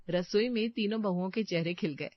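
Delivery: ordinary delivery, nothing marked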